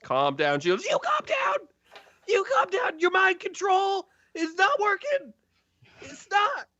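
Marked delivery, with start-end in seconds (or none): 0.8-1.7 shrill-high furious shriek; screaming retort. Acted. 2.3-6.7 very high, hysterical shriek; frantic screaming. Acted.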